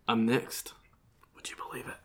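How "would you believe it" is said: breathy secret whisper. Acted. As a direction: lean in and whisper it. Whispered aside before his story.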